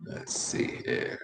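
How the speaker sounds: slow, soft whisper; whispering to self. Real, not acted. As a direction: whisper it absently while looking something up. Muttering to self while searching.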